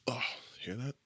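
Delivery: unvoiced whispered aside; hushed aside about creaky joints. Real, not acted.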